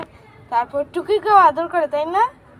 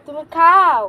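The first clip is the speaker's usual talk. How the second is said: firm, louder call to eat; loud urging; 8 dB louder, slower than usual. Real, not acted. Mother urging child to eat. (জোরে তাড়া দিয়ে)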